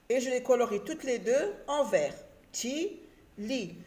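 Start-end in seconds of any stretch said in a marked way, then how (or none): 2.5-3.7 "Ti, li" softly, as two separate syllables; split into syllables. Shown as a demonstration. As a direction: pause between "Ti" and "li". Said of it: "la deuxième syllabe du mot".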